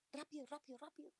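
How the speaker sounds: mother hurrying her daughter through homework, retold yell; impatient yell. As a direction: relive pushing the daughter to hurry, half-shouted. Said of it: "yo empecé a gritar a mi hija".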